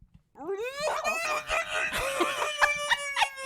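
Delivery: long high wavering llama cry; llama noise. Pretend. Taking a turn at llama noises, high mock bleat. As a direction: hold a silly, high animal bleat. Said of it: "Uh, here we go".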